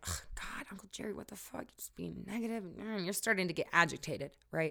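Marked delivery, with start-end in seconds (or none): 0.1-2.6 muttered under the breath, softer; mock exasperated grumble; annoyed groan. You fed up with Uncle Jerry, softer grumble. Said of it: "you're like".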